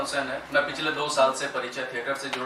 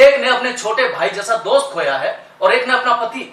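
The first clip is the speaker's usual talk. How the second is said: loud, higher, emphatic declaration; impassioned plea; 9 semitones higher, 9 dB louder than usual. Acted. Lawyer defending the two accused to the judge, impassioned. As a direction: press the point forcefully.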